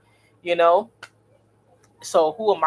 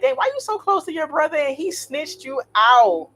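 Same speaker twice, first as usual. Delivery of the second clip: high, disbelieving mock question; incredulous retort; 10 semitones higher than usual. Mocking her ex's friend for trusting a snitching brother, high and incredulous. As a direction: throw the question back in disbelief. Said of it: "And I be like".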